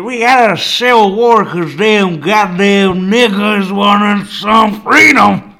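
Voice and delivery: loud, high-pitched Southern drawl; hammed-up American Southern holler; mock Southern accent. Caricature retelling Civil War as Southerner.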